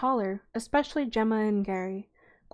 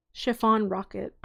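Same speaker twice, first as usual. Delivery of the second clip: ordinary delivery, nothing marked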